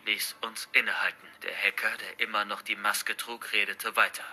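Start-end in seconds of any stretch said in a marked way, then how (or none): none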